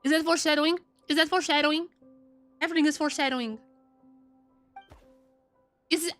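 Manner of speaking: usual speaking voice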